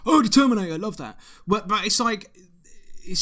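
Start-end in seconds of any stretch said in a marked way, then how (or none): 0.1-1.2 excited. Retold or imitated. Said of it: "They'll be like".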